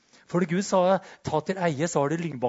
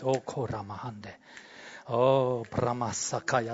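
soft tongues; 6 semitones lower, 5 dB quieter, slower than usual. Real, not acted. Quiet tongues while listening to God.

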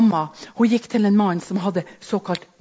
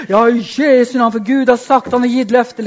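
louder, hammed-up healer proclaiming; mock faith healer; 3 semitones higher, 9 dB louder than usual. Preacher mocking a healer at a sick person, louder and hammed-up. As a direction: parody a showy faith healer.

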